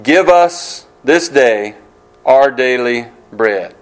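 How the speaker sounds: slow, reverent quoting of the prayer; measured prayer recital; slow scripture reading. Shown as a demonstration. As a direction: lay out each word of "Give us this day our daily bread". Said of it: "the phrase in the Lord's Prayer".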